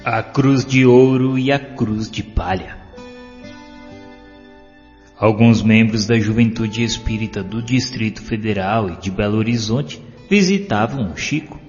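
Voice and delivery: ordinary delivery, nothing marked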